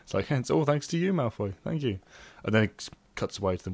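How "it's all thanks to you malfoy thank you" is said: lightly acted mock-grateful jab, pitched higher; gleeful jab. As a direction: throw mock thanks at a rival with a touch of glee. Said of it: "gleeful, and satisfying jab".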